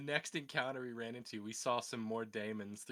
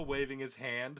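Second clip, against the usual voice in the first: usual speaking voice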